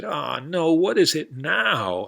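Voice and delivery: cowboy fed up with his horse, high and loud; exasperated cowboy. Acted. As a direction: throw up your hands like a cowboy.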